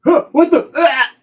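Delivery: high, loud startled cry; gasp, then yell in shock; mock panic as he falls; 1 shocked yelp. Watching the man fall.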